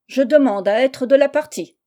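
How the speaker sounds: loud call from outside. A narrator's reading. A voice outside the house asks to join, calling out loudly. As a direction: raise the voice to be heard indoors. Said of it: "cria une voix en dehors de la maison".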